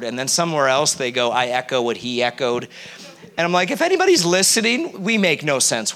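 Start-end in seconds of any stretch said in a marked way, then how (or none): 1.3-2.7 put-on a light Southern accent for the copycat prayer. Preacher mocking the second praying man in a Southern accent. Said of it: "I'm trying to do a bit of an accent".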